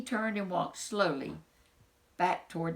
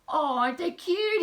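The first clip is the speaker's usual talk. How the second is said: high, loud gush over the little ones; delighted coo; 11 semitones higher, 5 dB louder than usual. Acted. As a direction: squeal at how cute they are. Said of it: "he said".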